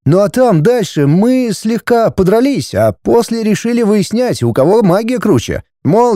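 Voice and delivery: put-on high-pitched boy explaining himself; sullen teenage son. Son confessing the duel to parents, sullen. Teenage son's figure in dialogue.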